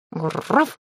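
short growled woof with rolled r; dog's bark. A narrator's reading. Dog answers on being introduced, growly bark. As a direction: give a rolled-r doggy woof. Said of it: "подал тот голос".

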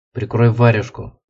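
ordinary delivery, nothing marked

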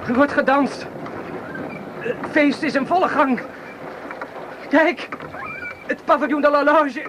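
rising, feverish cheering at the blaze; feverish excitement. Acted. Wounded soldier cheering Paris burning, delirious excitement. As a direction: cheer the explosions as if at a party, in delirium.

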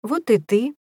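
high, fake-sweet cooing; sing-song insincere affection; kindergarten teacher voice. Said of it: "в неискренней ласке".

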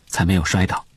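ordinary delivery, nothing marked

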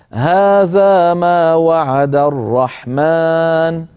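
slow chanted recitation; Quran recitation. Shown as a demonstration. Teacher resumes the verse after the stop, in slow chanted recitation. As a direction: chant the verse slowly and evenly. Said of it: "ثم نقول".

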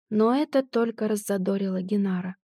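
ordinary delivery, nothing marked

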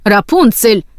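sharper cry of the name, restrained; understated angry outburst; mild narrated shout. A narrator's reading. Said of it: "закричала матушка Готель".

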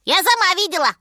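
high, loud monkey squeal; shrieking little monkey. A narrator's reading. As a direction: call out excitedly in a high monkey voice. Monkey insists loudly.

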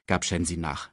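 ordinary delivery

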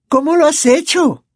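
high, loud amazed ask; delighted question. A narrator's reading. Angelina asks George about the feast, high amazed. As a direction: marvel at the treats. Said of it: "preguntó Angelina Johnson".

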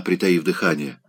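ordinary delivery, nothing marked

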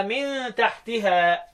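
من تحتها recited slowly as "min tahtiha"; slow recitation, من تحتها as "min tahtiha"; kasra reading. Shown as a demonstration. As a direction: say من with an i and تحتها with an i after the second t. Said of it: "تبقى مكسورة".

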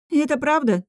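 high-pitched, disgusted question; appalled and incredulous; wincing disbelief. A narrator's reading. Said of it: "скривилась я".

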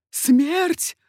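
high, loud, horrified cry; old man's cry. A narrator's reading. Old father hearing his son's sentence, crying out. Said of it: "вскрикнул старик".

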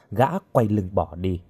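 plain reading voice

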